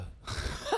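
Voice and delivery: airy high-pitched giggle; high breathy laugh. Real, not acted. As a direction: burst out laughing. Reacts to crude remark.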